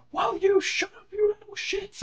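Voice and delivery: high shrill mock snap; mock snapping teacher. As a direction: imitate the teacher losing it. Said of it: "she snaps and she goes".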